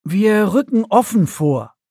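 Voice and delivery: commanding woman leader; decisive commander. A narrator's reading. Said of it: "entschied Thora".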